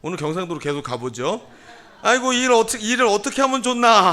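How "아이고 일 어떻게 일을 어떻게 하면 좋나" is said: acted dismay. Acts out a fretful exclamation.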